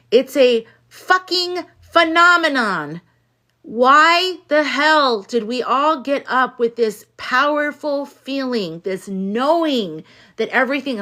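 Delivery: loud, high and slow emphatic rant; loud outburst. Real, not acted. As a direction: belt it out with conviction. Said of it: "my loud ass voice".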